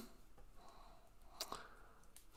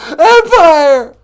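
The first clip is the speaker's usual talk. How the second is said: high loud indignant "Empire!"; shouted Empire; 12 semitones higher, 15 dB louder than usual. Acted. Mock caller shouting his show's name at the host.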